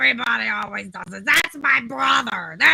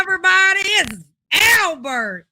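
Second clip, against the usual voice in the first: fast, high and loud, a showman's hello; big entrance as Albert; 7 semitones higher, 6 dB louder than usual. Acted. Guest switching to his other personality hails the audience, high and fast. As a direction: burst in as the brother with a loud, high greeting. Transforms into alter ego Albert.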